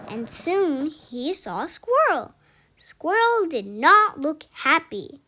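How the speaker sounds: usual speaking voice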